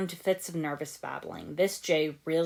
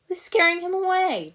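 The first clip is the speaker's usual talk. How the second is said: high, loud indignant protest; indignant Jay; 8 dB louder than usual. Acted. Jay protesting to Cole, high and indignant. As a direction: squeak out the protest, offended.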